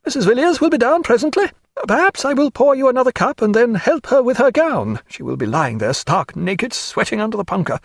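high-voiced old society hostess; coarse old lady, chatty. Lady Forbes gossiping to Stephen over tea, high and chatty.

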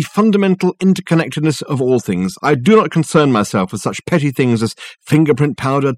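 self-assured lecturing to a phone client; grandiose detective, expounding. Acted. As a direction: expound grandly, then settle into a courteous sign-off.

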